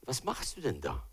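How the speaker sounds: very quiet inner voice. Retold or imitated. God's quiet voice speaking inside him, hushed. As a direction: ask it barely above a breath. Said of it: "die ich einfach in mir so ganz leise höre".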